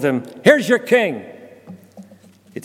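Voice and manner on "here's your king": high, loud "Here's your king"; mocking presentation. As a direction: show him off with contempt, raised and loud. Pilate presents Jesus to the mob.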